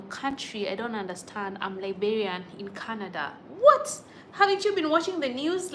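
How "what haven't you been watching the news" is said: loud, high shocked outburst; stunned, raised voice at a viewer; incredulous. Real, not acted. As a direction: blurt it out in disbelief. Reacting to viewer comment, incredulous.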